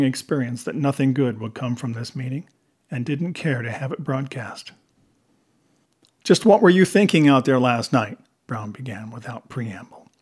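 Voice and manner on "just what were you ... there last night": louder, pointed demand for answers; irritated boss, voice raised; stern reprimand. A narrator's reading. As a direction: launch straight into the dressing-down. Lieutenant reprimands Nate without preamble.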